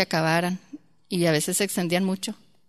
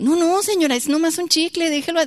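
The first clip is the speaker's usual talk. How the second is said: high, louder imitated protest; flustered shopkeeper protesting; 10 semitones higher, 4 dB louder than usual. Shopkeeper begging the mother to drop it over one gum, flustered. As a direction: act out a flustered plea to let it go.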